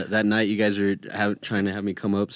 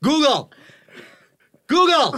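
high, loud mock yell at the smart speaker; yelling at Google; 6 dB louder than usual. Roommate imitating someone yelling at Google, loud and high. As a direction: shout the name up high and loud, twice. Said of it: "You know what I hear?".

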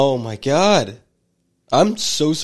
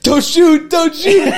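much higher, louder, hammed-up panic; mock terrified plea; 10 dB louder, slower than usual. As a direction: yell like a cornered suspect. Mocking customers' raised hands.

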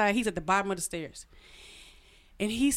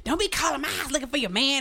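raised-pitch scolding, imitating the other woman; going off; 5 semitones higher than usual. Woman warning a late-night caller, mock indignant scolding. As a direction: mimic an indignant telling-off. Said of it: "she goes off on me".

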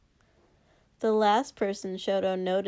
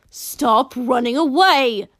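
frustrated grunting shout; 4 semitones higher, 9 dB louder, slower than usual. Acted. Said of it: "she grunted".

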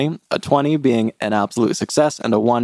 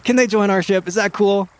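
high-pitched, eager request; pitched-up gnome voice; gnome asking captain; 8 semitones higher than usual. Acted. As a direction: plead with the captain in a high little voice. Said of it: "He looks up at the captain and says".

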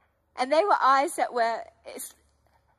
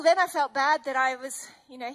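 ordinary talk, nothing marked

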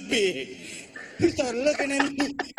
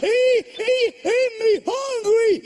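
high, loud, slow brother voice; imitating his brother; 11 semitones higher, 6 dB louder, slower than usual. Comic voicing his hungry brother, high and loud. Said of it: "I'm like".

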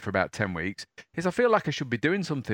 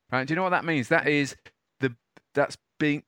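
usual speaking voice

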